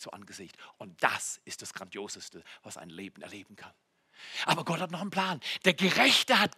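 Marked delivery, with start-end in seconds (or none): none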